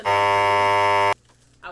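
low, loud and very slow; dramatic deep voice. Pretend. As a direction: drag it out like a movie trailer. Dramatic repeat of line 85.

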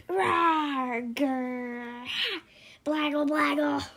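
growly mock monster noises; monster roar. Child reading, roaring as the monster at the boy. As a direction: give it all you've got like a little monster. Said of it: "the monster gave it all he had".

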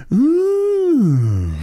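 long, high, loud "ooh"; hammed-up coo of wonder, held high; impressed "ooh". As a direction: let out a big mock-awed ooh. Acting out own reaction to the trick.

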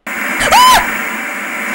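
a sudden high breathless scream of fright; startled shriek. Real, not acted. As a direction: jump out of your skin and yelp. Startled by game jumpscare.

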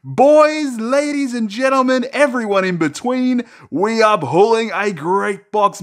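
sky-high, loud showman hype over a big pull; excited hype. Real, not acted. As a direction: hype it up like a ring announcer for the viewers. Hyped pack pull, showman address.

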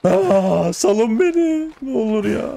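high, slow begging wail; mock pleading whine. Real, not acted. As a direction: drag it out like a begging victim. Begging the wolves to stop.

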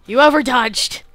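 high, very loud scolding; exasperated yell. Real, not acted. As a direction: yell at your own blunder. Yells at own game mistake.

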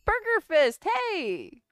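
high, loud cheery hello; excited greeting. Real, not acted. Greeting a new viewer in chat, high excited call. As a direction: call out a happy welcome to a viewer.

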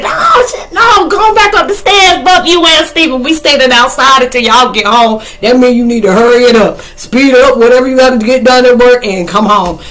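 loud, high mock-panicked outburst; hammed-up alarmed scolding; alarmed ordering. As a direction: yell orders as if spooked. Acted panicked orders at the movie.

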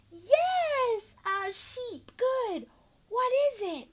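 high excited praise; bright kid-show encouragement; praise child. Shown as a demonstration. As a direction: cheer a small child on. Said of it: "teacher performing ESL demo".

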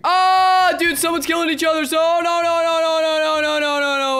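panicked outburst. Real, not acted. Gamer seeing someone's killing each other, panicked outburst. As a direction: rattle off the no's in one long panicked stream.

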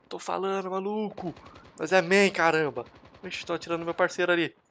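ordinary talk

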